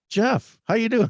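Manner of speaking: high, bright hello; excited greeting. Real, not acted. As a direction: greet him big and high. Greeting guest on air.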